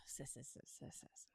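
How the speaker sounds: hissing sis fading to a whisper; sis, sis, trailing off into whisper; s drill. Shown as a demonstration. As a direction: drill the S sound. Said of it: "S's are my nemesis".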